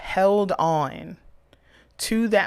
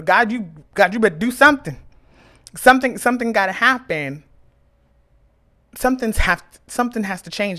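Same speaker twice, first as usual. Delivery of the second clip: higher and louder, bargaining with God; loud demanding prayer; 4 semitones higher, 5 dB louder, faster than usual. Retold or imitated.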